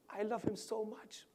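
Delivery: high, whispery confession; high and half-whispered, tearfully acted; imitated tearful love. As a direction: act choked with love. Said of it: "she's crying and talking, crying and talking".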